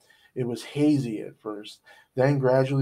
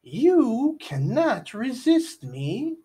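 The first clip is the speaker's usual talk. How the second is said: much higher, very slow taunt; menacing princess; 12 semitones higher, 3 dB louder, much slower than usual. A narrator's reading. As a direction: draw it out like a cruel sorceress. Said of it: "said the princess".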